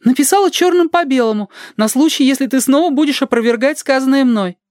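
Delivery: very high, reproachful character voice; creaky-voiced woman. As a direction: scold pedantically in a high woman's voice. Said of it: "Она говорит своим скрипучим голосом".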